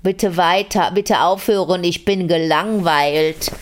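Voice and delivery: very slow, arrogant bored delivery; mock bored drawl. Shown as a demonstration. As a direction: drag each word out as if bored stiff. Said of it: "so wahnsinnig arrogant und vor allem so gelangweilt". (arrogant gelangweilt, stark gedehnt)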